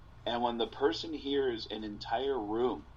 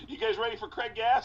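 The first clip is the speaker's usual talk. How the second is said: higher, quick panicked handoff; nervous new comic; 9 semitones higher than usual. Retold or imitated. New comic dodging a heckler by calling the next act, higher. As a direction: bail out fast to the next act. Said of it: "And Jason goes".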